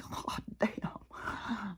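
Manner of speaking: soft, whispered curse under the breath; whispered swear, worn out; muttered curse. Retold or imitated. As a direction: breathe it out, beaten. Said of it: "I'm like".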